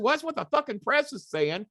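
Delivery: high, loud, exasperated demand; imitating a furious colleague's phone call; angry Cornette. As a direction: bark it like a furious boss on the phone. Cornette voiced ranting.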